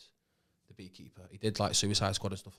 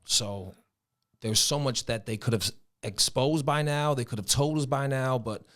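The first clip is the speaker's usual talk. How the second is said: put-on general American accent, higher pitched; 4 semitones higher than usual. Asked to talk on a topic, in an American accent. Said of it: "I can do American".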